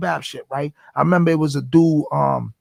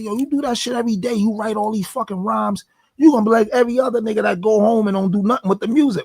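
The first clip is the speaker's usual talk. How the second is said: higher-pitched imitation of a doubter; doubting heckler; 6 semitones higher than usual. Inmates tell the rapper he will quit, mock skeptical. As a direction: voice a skeptic needling you, pitched up.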